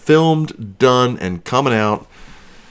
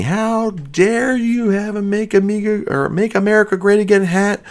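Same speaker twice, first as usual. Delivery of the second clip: higher mock outrage of a hater; mocking angry commenter; 10 semitones higher than usual. Mocking hateful commenters about his hat, higher-pitched outrage. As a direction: whine it like an offended YouTube commenter. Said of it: "comments ... smashing me".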